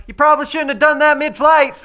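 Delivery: called out high and loud, laughing; yelled out. Pretend. Harley yelling up at the flying hero, laughing shout. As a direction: yell it across mid-flight, half laughing. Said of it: "I just yell out going".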